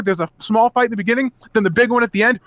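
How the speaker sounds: usual speaking voice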